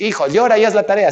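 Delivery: tossing off the wrong reading as the dad; mock dad's order. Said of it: "no te dice".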